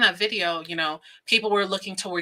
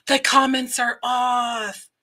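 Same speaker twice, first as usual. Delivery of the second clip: slow, loud exclaim; outraged slow shout; 5 semitones higher, 4 dB louder, slower than usual. Pretend. Whispered then shouted discovery.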